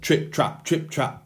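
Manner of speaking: stamping hooves. A narrator's reading. Eldest goat stamping on the bridge, punchy hoof steps. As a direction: tap out each word like big hooves. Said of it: "he stamped his feet".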